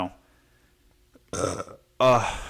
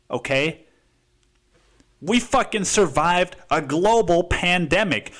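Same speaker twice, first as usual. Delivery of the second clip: usual speaking voice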